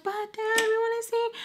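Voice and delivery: high, soft child imitation; pleading little kids. Young brothers begging their dad, high plea.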